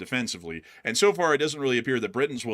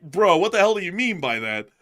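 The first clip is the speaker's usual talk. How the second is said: normal talking voice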